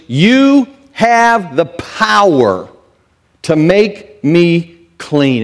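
slow, high pleading; leper's plea. Acted. A leper asking Jesus for healing, slow and pleading. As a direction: stretch out each word of the plea. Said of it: "saying to Jesus".

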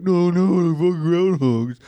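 put-on voice gushing over cute animals; mocking imitation. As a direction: mimic a friend cooing in protest. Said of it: "And you're like".

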